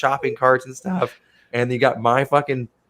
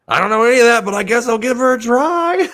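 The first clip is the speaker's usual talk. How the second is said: put-on old man voice; 12 semitones higher, 7 dB louder than usual. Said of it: "one old guy was like".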